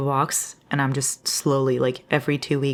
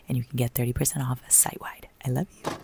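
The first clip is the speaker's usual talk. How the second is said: soft whisper to the camera; 5 dB quieter than usual. Acted. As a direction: breathe it like a secret. Whispered sponsor sign-off.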